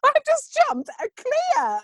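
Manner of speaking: very high, slow elated squeal; excited squeal. Retold or imitated. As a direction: squeal it out with joy. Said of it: "I was just like".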